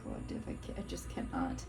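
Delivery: whispered, fast disbelief; stunned whisper. Real, not acted. Tarot reader telling the collective, whispering in disbelief. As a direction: drop to a breathy whisper, overwhelmed.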